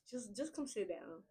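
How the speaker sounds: hushed, slow, gentle coaxing; gentle correction. Acted. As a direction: softly invite them to sit down. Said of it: "it's very gentle".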